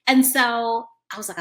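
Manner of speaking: normal talking voice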